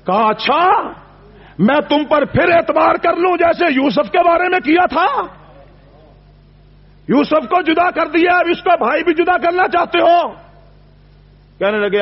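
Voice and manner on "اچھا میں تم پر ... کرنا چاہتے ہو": raised, hurt voice of a grieving father; father's bitter reproach. Acted. Father Yaqub reproaching his sons for losing Yusuf, raised hurt reproach. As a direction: let the old father's distrust ring out.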